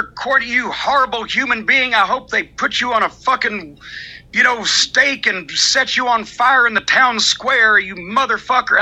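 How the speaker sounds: ordinary delivery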